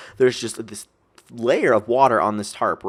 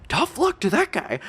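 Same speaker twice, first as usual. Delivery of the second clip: much higher smug jab; mock cocky retort; 13 semitones higher than usual. To her friend about the other guy, a high smug jab. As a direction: toss it off like a cocky rival. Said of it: "you wanna know the thing about that".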